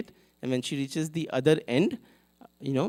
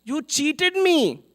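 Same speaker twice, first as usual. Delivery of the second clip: heartbroken reproach; 8 semitones higher, 5 dB louder than usual. Retold or imitated. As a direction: cry out to Krishna as the one who cheated her. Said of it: "He said".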